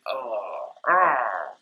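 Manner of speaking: disgusted drinker's groan, acted out; angry groan. Reacting to a drink going down badly, acted angry groan. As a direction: groan as if the drink angers you. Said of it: "making me angry. When I drink it, I'm like".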